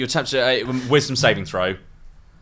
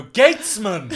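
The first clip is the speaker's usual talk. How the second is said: loud call; 8 semitones higher, much slower than usual. Acted. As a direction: shout up to the gatesman. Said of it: "Very loud".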